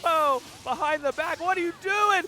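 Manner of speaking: high, loud hype play-by-play; esports caster. Real, not acted. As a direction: yell the comeback as it happens. Caster reacts to a comeback.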